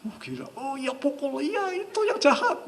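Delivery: high squeaky mock soap-opera fan; engrossed TV viewer. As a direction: play a mother glued to her drama, egging it on. Acts mother engrossed in soap opera.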